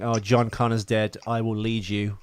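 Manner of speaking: ordinary delivery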